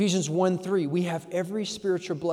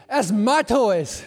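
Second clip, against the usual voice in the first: slow, loud, higher mock-stern; fatherly claim; 5 semitones higher, 9 dB louder, much slower than usual. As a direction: own it firmly to tease the kid. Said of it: "I said".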